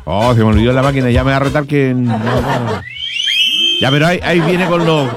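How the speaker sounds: ordinary talk, nothing marked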